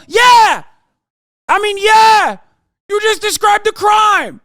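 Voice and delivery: loud, high mock cheer of agreement; sarcastic shout. Streamer mocking the pundits' reasoning with a loud sarcastic shout. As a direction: yell it like the point is painfully obvious.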